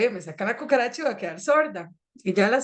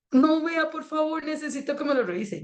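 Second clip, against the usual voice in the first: pleading; 6 semitones higher than usual. Retold or imitated. Patient begging, quoted.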